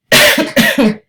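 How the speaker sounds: loud dry cough; harsh cough. Real, not acted. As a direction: cough hard. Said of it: "Excuse me for coughing like that".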